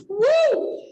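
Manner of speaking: high, loud whoop; excited whoop. Real, not acted. Cheering the audience about being the patch lady, high and loud. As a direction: whoop like at a rally. Cheering at her own boast.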